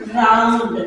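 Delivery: राउंड, रील, रोल, रन each opened on a lingering r; isolated teacher's demo of r in राउंड, रील, रोल, रन; vibrating r. Shown as a demonstration. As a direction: let the tongue quiver on the first sound of राउंड, रील, रोल, रन. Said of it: "इसी तरीके से आर".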